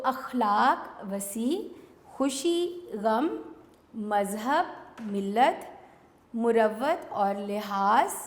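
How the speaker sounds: very slow, each pair drawn out for writing down; dictating word pairs slowly to pupils; slow dictation. Shown as a demonstration. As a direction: spell it out slowly so the class can copy. Teacher dictating matched word pairs.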